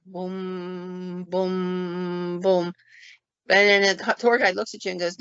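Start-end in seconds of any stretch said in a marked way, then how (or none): 0.1-2.7 imitated clock booming. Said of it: "it starts booming".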